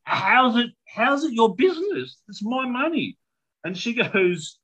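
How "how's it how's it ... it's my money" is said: high-pitched retort of an affronted mother; retold indignant outburst; indignant mum. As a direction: push the pitch up, offended. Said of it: "And my mum's like".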